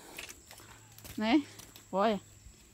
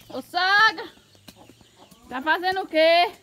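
loud, higher call across the yard; 7 semitones higher, 7 dB louder than usual. Real, not acted. Vlogger calling out loud to her mother-in-law. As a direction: shout over to her from a distance.

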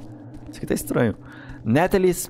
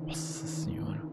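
whispering; 3 semitones higher, 14 dB quieter than usual. Real, not acted. Sneaking past the old man.

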